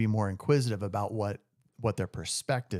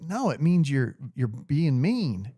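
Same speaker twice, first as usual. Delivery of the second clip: slow, drawn-out Southern "being mean"; mock Southern girlfriend explaining; Southern accent; 4 semitones higher, 3 dB louder, slower than usual. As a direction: stretch "mean" like a Southern lady correcting him. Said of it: "she got her Southern accent".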